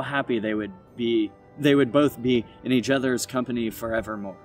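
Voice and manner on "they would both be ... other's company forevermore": plain reading voice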